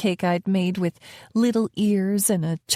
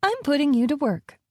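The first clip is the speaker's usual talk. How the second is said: higher, bright chirp; 7 semitones higher than usual. Acted. Nanny cheerfully telling the girl she's going to work, higher chirp. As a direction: sing it out cheerfully, pitched higher. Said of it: "I chirped".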